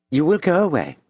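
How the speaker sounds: a gentle threat; Madame warning. A narrator's reading. Madame warns.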